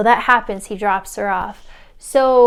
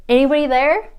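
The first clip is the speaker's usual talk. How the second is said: louder, higher questioning call; 4 semitones higher, 6 dB louder, faster than usual. Retold or imitated. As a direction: call out to someone unseen. Calling from car window.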